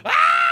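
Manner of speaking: high, loud screaming yell; mock gunman scream. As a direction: yell out like a crazed clown attacker. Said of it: "shot the gun in the air going".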